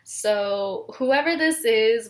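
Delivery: ordinary talk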